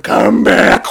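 loud mock-wailing shout; hammed-up sobbing plea; crying. Dragon chasing the sailor in tears, crying. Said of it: "she starts to cry".

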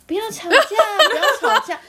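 high, slow laughing imitation of a drunk; drunk pleading. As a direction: mimic the drunk friend, laughing. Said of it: "斗鱼帮的朋友就在那边". (笑着模仿醉汉，声音高而慢)

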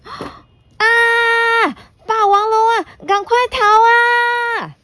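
high, frightened yell; panicked scream. Acted. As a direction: run away shrieking in fear. Dinosaurs flee the T-rex.

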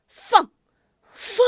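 short, high, loud order; relentless firing command; commanding shout. A narrator's reading. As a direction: keep ordering the archers on. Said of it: "继续指挥放箭".

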